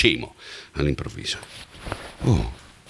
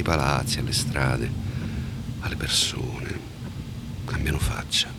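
quiet musing; 7 semitones lower, 8 dB quieter than usual. Acted. Thinking aloud alone at night.

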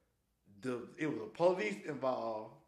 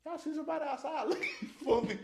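laughing question; 10 semitones higher, 3 dB quieter, faster than usual. Real, not acted. Asking coworkers amused.

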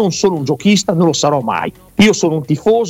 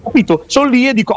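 usual speaking voice

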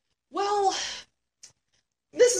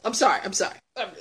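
ordinary talk

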